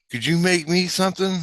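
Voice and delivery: very high imitated child voice; little kid asking. Kid asking for a favor, imitated high voice. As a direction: pitch it way up like a pleading kid. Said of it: "This little kid goes".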